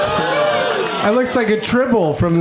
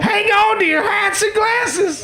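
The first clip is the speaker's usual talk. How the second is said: put-on old prospector; slower than usual. Continues the prospector bit.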